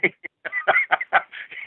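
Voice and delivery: shrill jeering laugh up high; taunting laugh after the insult; high mocking cackle. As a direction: cackle at him like a prankster. [name] laughing at locked-out Blackie.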